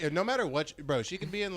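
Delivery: usual speaking voice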